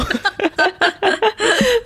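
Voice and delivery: high burst of laughter; real high giggle. Real, not acted. Laughing at joke.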